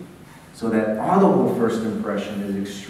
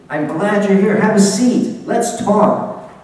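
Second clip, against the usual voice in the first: higher, louder, eager greeting; a host eagerly welcoming a guest, higher and louder; excited welcome; 7 semitones higher, 4 dB louder, slower than usual. Shown as a demonstration. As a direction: greet them like you're thrilled they came. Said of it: "I'm excited to see you".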